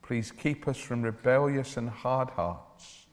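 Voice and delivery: ordinary delivery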